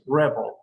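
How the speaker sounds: rebel as REB-el, weight up front; stress on first syllable. Shown as a demonstration. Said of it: "it's at the beginning".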